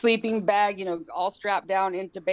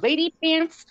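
mock squeal; 10 semitones higher, 6 dB louder than usual. Mocking nurse's remark.

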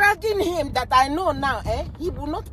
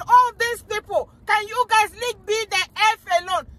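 mock-angry outburst, starting high; 6 semitones higher than usual. Politician finally snaps at his critics, mock-angry outburst. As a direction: imitate someone finally losing patience. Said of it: "get angry and said".

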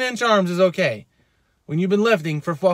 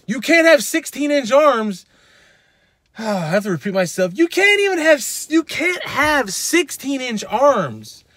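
exasperated insistence; 6 semitones higher, 3 dB louder than usual. Real, not acted. Exasperated repeat of point.

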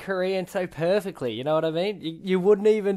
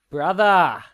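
shouted Brother; loud hype call; 3 semitones higher, 8 dB louder than usual. Real, not acted. Hyping the Brisbane connection with a shout.

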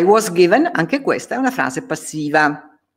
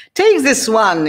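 loud, raised order; commanding sorcerer; 8 semitones higher, 6 dB louder than usual. Acted. Faustus ordering his devils, commanding.

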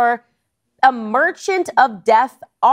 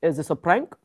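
bad Russian accent; 3 semitones lower, 6 dB quieter than usual. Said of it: "very bad Russian accent".

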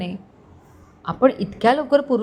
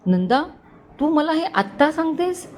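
mildly vexed, pitch jumping on the question; exasperated; 7 semitones higher than usual. A narrator's reading. Husband to wife over late news, mildly exasperated. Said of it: "Abhijit वैतागून बोलला".

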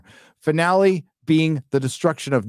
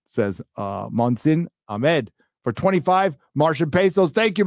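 ordinary talk

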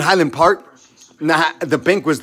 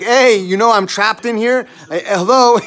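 higher, louder calls through the door; calling out for help; 6 semitones higher, 6 dB louder than usual. Retold or imitated. Trapped in the bank door, calling to people outside loudly. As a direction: knock and shout to be let out.

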